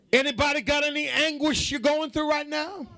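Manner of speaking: high, sustained preacher's call in repeated cadence; rallying preacher. Real, not acted. Preacher rallies troubled congregation with repeated questions.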